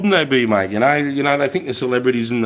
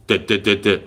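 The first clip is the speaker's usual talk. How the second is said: staccato mouth-made gunfire; imitation of repeated firing; imitated war noise; 4 semitones lower than usual. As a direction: rattle out evenly spaced shots. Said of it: "is how noisy it is".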